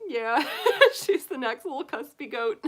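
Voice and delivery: high, laughing through the words; laughing joke. Retold or imitated. Joking to viewers about [name], laughing. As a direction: giggle it out as a cheeky reply. Jokey reply to people's remark.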